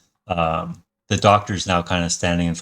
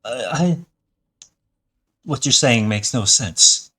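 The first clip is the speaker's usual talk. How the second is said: confused doctor; 6 semitones higher than usual. Acted. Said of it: "confused look on his face and says".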